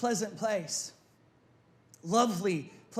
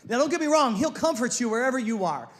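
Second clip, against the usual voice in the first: ordinary delivery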